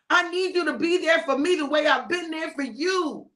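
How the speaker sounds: high, insistent appeal; hurt pleading. Acted. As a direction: beg friends to finally understand and show up. Hurt plea to uncomprehending friends.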